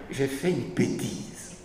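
lightly voiced sheepish little boy, slow; a hint of a child owning up after spilling his cocoa. Lecturer mocking Heidegger's reply as a lightly voiced guilty little boy. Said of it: "comme un petit garçon qui vient de renverser son bol de chocolat".

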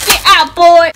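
sudden loud order; loud shout. Real, not acted. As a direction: bark it out at the dog. Shouting at the dog mid-video.

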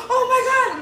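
high, loud startled cry. Retold or imitated. Husband waking frightened in the fog, startled and loud. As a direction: jolt awake in fright.

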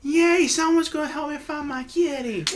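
put-on high, bright little girl voice; little girl cheering. Little girl thrilled the party will help find her kitty. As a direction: chirp it like a delighted small child.

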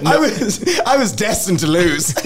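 high, loud, laughing lament; laughing self-mockery. Real, not acted. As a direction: crack up at your own doom. Laughing at his own bad luck.

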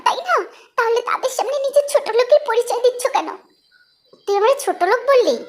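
high, indignant, hammed-up rebuke; mock outraged wife. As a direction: scold him shrilly in front of mom. Wife rebukes husband for rudeness.

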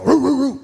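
barking like a dog. Retold or imitated. Comic imitating a distant dog barking. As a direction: imitate a dog's roo roo. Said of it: "that's a dog".

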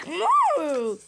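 high, loud whooped moo; excited pretend cow; happy cow moo. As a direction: moo out in delight at hay. Cow delighted at more hay.

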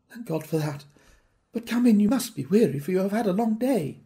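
a hint of a relieved hostess; lightly voiced lady.

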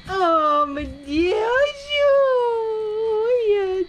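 high, loud burst of delight; excited squeal. Real, not acted. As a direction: gush over the pet, squealing. Sudden delight at pets. (gritinho animado de carinho)

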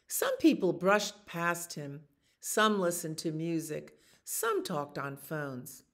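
animated picture-book narration; reading to children. A narrator's reading. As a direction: tell the story to little listeners. Reads picture book aloud.

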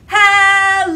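a big, high, loud call. Shown as a demonstration. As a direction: shout it out as loud as you can. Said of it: "Let's say hello as loud as we can".

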